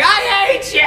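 high, loud imitated outburst; mock kid's tantrum. Boy defying dad over bedtime, mock furious yell.

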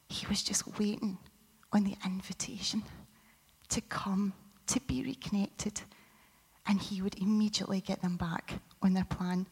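soft whispered sermon passage. Acted. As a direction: whisper it gently, like sharing a secret of grace.

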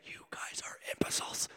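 whispered aside; mock whisper. Pastor whispering God's blunt insult to the congregation. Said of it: "his nice version of saying".

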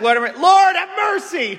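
high, acted shout of pleading; imagined cry for mercy. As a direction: cry out as if begging to be heard. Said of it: "Imagine it was like".

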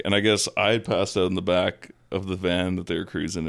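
ordinary talk

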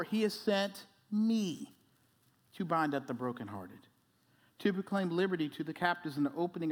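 soft measured reading of the Isaiah passage; scripture reading. Acted. Preacher reads Isaiah aloud to the church, soft and measured. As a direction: read it hushed and unhurried to the congregation.

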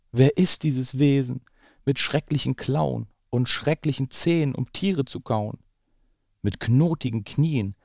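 put-on lively read-aloud voice for children; story narration. A narrator's reading. Parent reading The Gruffalo to the children, lively storytelling. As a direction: tell it like a bedtime story. Reads picture book aloud.

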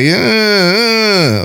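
imitating a grumpy child, high and whiny; loud, high mock sulk; kid's attitude. Sleepy son giving the barber attitude, mock whine. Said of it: "he having an attitude with him".

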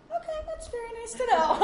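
very high, strained polite reply; spooked politeness. Retold or imitated. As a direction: squeak it out as if humoring her. Spooked, politely answering a ghost claim.

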